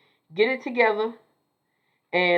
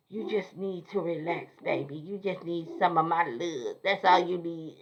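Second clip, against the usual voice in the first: fake Sandra Clark; 4 semitones lower, 6 dB quieter than usual. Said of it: "Sound like a fake Sandra Clark".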